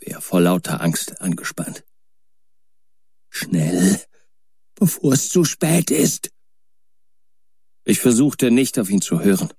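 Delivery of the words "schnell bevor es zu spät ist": a hint of hopeless resignation, higher and distant; softer, resigned Warren. A narrator's reading. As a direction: urge him gently but tense with fear. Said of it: "Diesmal wurde er weicher".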